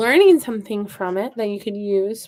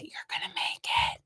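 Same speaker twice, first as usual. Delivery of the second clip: soft whisper to a seedling; whispered encouragement; 11 dB quieter than usual. Real, not acted. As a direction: whisper it as private reassurance.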